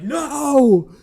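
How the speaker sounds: high loud yell; hammed-up panicked scream. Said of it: "It's like".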